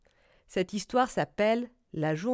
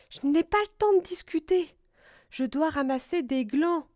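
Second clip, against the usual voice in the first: higher-voiced busy squirrel; Écureuil; 6 semitones higher, 3 dB louder than usual. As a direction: brush off small talk in a raised pitch. Écureuil pressé refuse de parler. (voix haute d'écureuil pressé)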